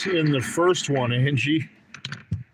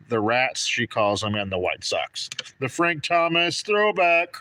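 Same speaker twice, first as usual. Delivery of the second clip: normal talking voice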